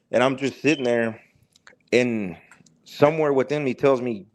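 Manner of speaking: ordinary delivery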